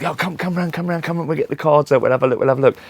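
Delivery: high, eager invitation to come round; excited psychic friend. Retold or imitated. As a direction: imitate the gushing psychic friend.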